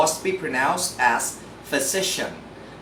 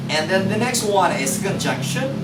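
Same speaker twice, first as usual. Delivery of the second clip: ordinary talk, nothing marked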